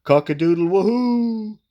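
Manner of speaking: high, loud cock-a-doodle whoop; shouted rooster crow. A narrator's reading. Rooster shouting with glee as he drops in. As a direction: crow it out with joy. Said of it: "shouted Cocky".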